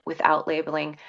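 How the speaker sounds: normal talking voice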